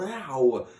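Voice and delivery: yelp. A narrator's reading. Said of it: "and he yelled".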